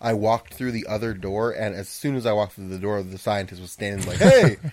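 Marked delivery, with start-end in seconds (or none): 4.2-4.6 loud, high mock yell; startled shout. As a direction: yell out like a guard spotting an intruder. Acting out scientist spotting him.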